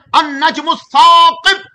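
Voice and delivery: very slow, high, loud drawn-out recitation; Quran recitation. Acted. Preacher recites the answer to his question, soaring chant. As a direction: lift and stretch the verse in full chant.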